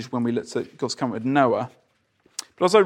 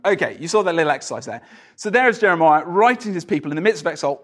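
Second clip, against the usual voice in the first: ordinary talk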